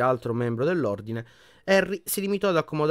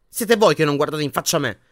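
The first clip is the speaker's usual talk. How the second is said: louder, stung comeback; angry retort; 4 semitones higher, 7 dB louder than usual. A narrator's reading. Harry snapping back at Ginny and Ron, angry. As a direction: throw it back at them angrily. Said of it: "ribatté Harry con rabbia".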